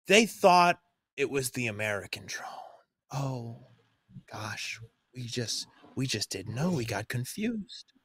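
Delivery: soft, hushed fake apology; mock innocence. Sarcastic mock apology for attack.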